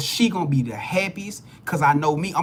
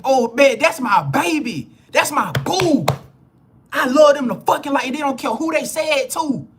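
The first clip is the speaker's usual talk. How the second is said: proud partner; 7 semitones higher, 4 dB louder than usual. Retold or imitated. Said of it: "they proud to say".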